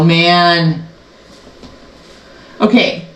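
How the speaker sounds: ordinary talk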